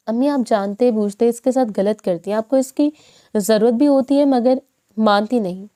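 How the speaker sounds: ordinary delivery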